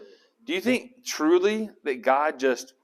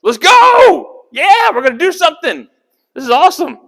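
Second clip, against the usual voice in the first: high, loud, hyped-up shouting; rally the troops with a fired-up yell; war cry; 13 semitones higher, 12 dB louder than usual. Acted. Soldier charging out to the battle line, shouted war cry. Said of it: "a shout of, of a war cry".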